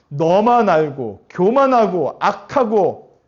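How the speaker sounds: loud, drawn-out list of faults; acted loud condemnation; accusing inner voice. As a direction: pound out each fault slowly and loudly. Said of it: "그러면서 얘기하죠".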